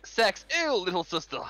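high-pitched, hammed-up revulsion; mock disgust. Gamer imitating a brother repulsed by his sister, mock disgust. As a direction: recoil in exaggerated disgust.

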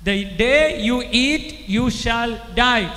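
very slow, spaced repeat; preacher slowing down so the hall can repeat; slow, drawn-out repeat. Real, not acted. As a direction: spell it out slowly for the crowd. Repeated slowly for the crowd.

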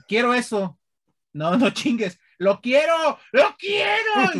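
rising to a very high, slow shout; mock tantrum. Spoiled child demanding a toy from his mother, escalating high shout. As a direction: whine then shriek like a spoiled kid. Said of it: "porque era de".

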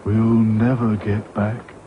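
slow, heavy gloom; gloomily. A narrator's reading. Sad Sack gloomily despairs, slow and heavy. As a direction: sigh the words out. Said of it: "said Sad Sack gloomily".

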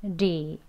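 gentle tapped "리". Shown as a demonstration. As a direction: tap "리" lightly, tongue relaxed. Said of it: "더 힘 빼셔서 부드럽게".

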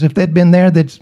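ordinary talk, nothing marked

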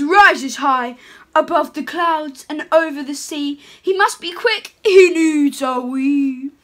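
put-on lively read-aloud for children; storybook reading. Santa reading a picture book to children, sing-song.

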